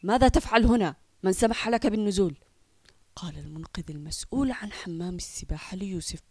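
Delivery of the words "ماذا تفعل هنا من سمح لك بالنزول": loud scolding challenge; stern lifeguard. Acted. Lifeguard scolding Yusuf at the pool, loud and stern. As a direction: bark at the boy, much louder.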